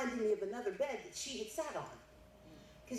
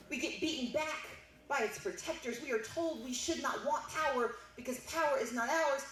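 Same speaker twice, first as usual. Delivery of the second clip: higher-pitched, driving political speech climax; rousing oratory; 4 semitones higher than usual. Real, not acted. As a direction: build insistently toward a ringing finish.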